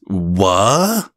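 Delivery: high, incredulous questioning; stammer, then demand to know; taken aback. Acted. Hears of ex's hurtful remark.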